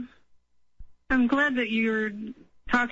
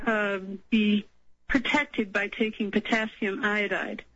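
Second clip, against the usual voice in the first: normal talking voice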